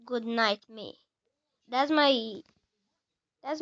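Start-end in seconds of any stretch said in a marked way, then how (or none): none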